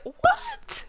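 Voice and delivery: high squealed What; hammed-up disbelief; mock shock. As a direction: yelp it out in fake surprise. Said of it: "And I was like".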